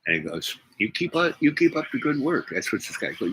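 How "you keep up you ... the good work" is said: high-pitched imitation of an encouraging agent; agent's encouragement. Departing agent praises the young inventor, high-pitched imitated encouragement. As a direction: lift the voice well up for quoted praise.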